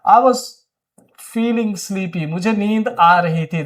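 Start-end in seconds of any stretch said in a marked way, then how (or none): 0.1-2.3 drawn-out pause before sleepy; slow model sentence. Shown as a demonstration. Teacher modelling an English sentence for Hindi learners, very slow. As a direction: dictate it slowly so students can follow. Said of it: "तो क्या बोलेंगे?".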